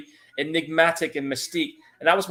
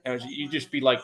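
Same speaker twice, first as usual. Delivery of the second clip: normal talking voice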